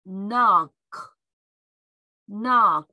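loud, emphatic "Knock" starting on N; English "Knock" with no initial K; silent K. Shown as a demonstration. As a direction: say "Knock" as nok, final k released. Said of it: "Kの音が消えて、Nの音だけになります".

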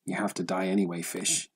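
ordinary delivery, nothing marked

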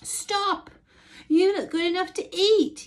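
high, loud yell; yelling boy. A narrator's reading. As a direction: yell after him. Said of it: "yelled the boy".

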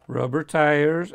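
slow, carefully spaced words. Real, not acted. Collector listing car features, slow and careful. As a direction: take it slowly to get it right. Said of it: "I have to say that slowly".

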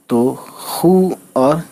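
ordinary delivery

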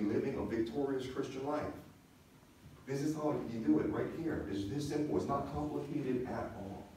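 normal talking voice

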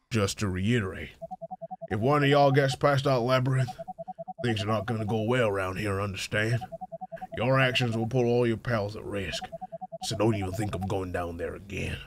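low, soft threatening boss voice; menacing warning. Acted. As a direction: quietly warn them not to go back.

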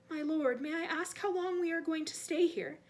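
deferential, nervous question; timid servant. Acted. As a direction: ask your master anxiously. Voices timid servant Wormtail.

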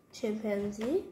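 usual speaking voice

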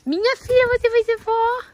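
high cooing to a pet. Real, not acted. Owner cooing to the pregnant mare.

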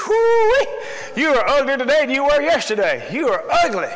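hammed-up mocking parrot; jeering parrot. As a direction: whoop it out, then rub in the insult. Parrot's mocking jeer in joke.

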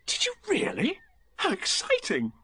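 high-voiced eager little boy; gasp, then marvel in a high voice; breath caught in delighted surprise; excited. Boy reacts eagerly to house news.

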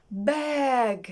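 "Бег" with a long stretched vowel; word modelling. Shown as a demonstration. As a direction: draw out "Бег" for pupils to copy. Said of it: "Том сказав". (протяжна вимова «Бег»)

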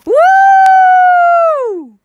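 victory yell, high and loud; excited shout. Real, not acted. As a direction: cheer the win at full voice. Celebrating a kill.

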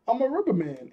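high, singled-out character line, lightly voiced; playful rubber-man boast. Luffy answering who he is, playful boast. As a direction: announce it high like a cartoon hero. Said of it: "And I missed it".

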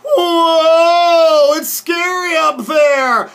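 high, loud, drawn-out alarm; scared cry. A narrator's reading. Danny up high begs dinosaur to get down, scared wail. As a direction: wail the plea in play-acted fright.

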